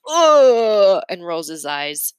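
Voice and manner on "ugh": loud groaning ugh of disgust; boy grossed out by the story, groaning. A narrator's reading. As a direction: groan like it turns your stomach. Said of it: "Calvin says".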